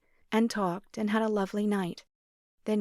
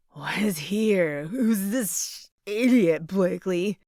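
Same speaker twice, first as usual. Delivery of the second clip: put-on slow, slurring drunk voice; slurred drunk; 4 dB louder, slower than usual. Drunk ex confronting the stranger at the door, slurring. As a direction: drag the words out, hammered. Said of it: "Darren slurred".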